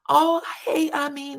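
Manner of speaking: high-pitched delighted hello; really happy greeting. Retold or imitated. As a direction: imitate someone thrilled to see a friend. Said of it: "she's really happy to see him".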